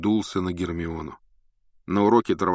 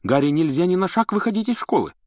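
indignant Hermione voice; 10 semitones higher, 3 dB louder than usual. A narrator's reading. Hermione's objection continues.

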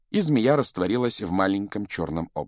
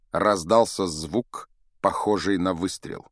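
ordinary delivery

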